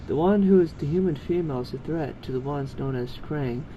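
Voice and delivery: usual speaking voice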